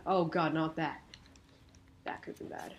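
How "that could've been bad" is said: breathy relieved whisper under the breath; hushed relief. Real, not acted. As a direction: whisper it to yourself after a close call. Near miss in game.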